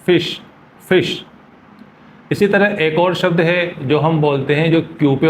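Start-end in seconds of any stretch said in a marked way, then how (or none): 0.1-0.4 फिश said higher, with a clear sh; correct fish, high. Shown as a demonstration. Teacher repeating फिश higher so students hear it. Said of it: "pronounce करना चाहिए आपको फिश". 0.8-1.2 फिश said higher, with a clear sh; correct fish, high. Shown as a demonstration. Teacher repeating फिश higher so students hear it. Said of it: "pronounce करना चाहिए आपको फिश".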